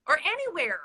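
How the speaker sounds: ordinary talk, nothing marked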